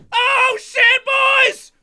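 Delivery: high, loud yelp of surprise; startled shout. Real, not acted. Streamer yelling to the boys as champagne sprays, startled.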